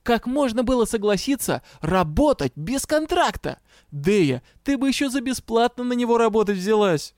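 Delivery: high, indignant tirade; storming on. A narrator's reading. Outraged gnome-like partner scolds her for unpaid work, storming. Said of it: "продолжал бушевать никакой не Дроу".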